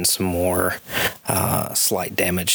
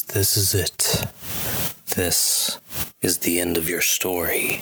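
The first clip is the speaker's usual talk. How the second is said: menacing villain. Acted. Villain declares the end.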